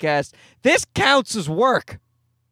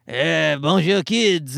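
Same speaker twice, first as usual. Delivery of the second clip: put-on creepy French kids' host, pitched lower; thick French accent; 4 semitones lower, slower than usual. French Blippi greeting the kids, thick creepy French accent. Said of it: "Thick French accent".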